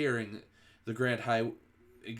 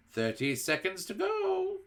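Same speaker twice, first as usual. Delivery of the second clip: high, slow witch countdown; witch crying out; 9 semitones higher than usual. A narrator's reading. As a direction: call out the seconds gleefully. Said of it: "cried the Grand High Witch".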